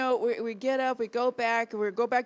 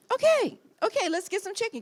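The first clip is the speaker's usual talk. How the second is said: much higher eager okay; 11 semitones higher than usual. Retold or imitated. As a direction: jump at it happily. Eager reply, high.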